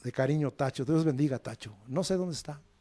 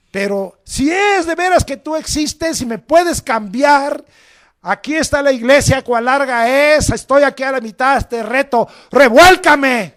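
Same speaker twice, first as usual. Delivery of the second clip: reto desafiante; 6 semitones higher, 8 dB louder than usual. Retold or imitated. Joven drogado reta a Dios.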